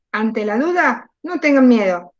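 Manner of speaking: ordinary talk, nothing marked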